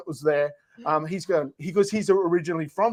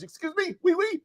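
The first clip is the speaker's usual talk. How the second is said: high-pitched pleading; 11 semitones higher than usual. Pretend. Comic demonstrating how to get off stage, in a high mock squeal. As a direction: squeak it out as a hammed-up plea.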